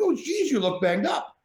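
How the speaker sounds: De Niro wincing at the bruises; De Niro impression. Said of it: "Robert De Niro goes by Bobby".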